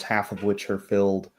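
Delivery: normal talking voice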